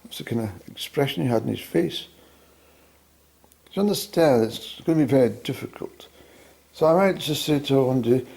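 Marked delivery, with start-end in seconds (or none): none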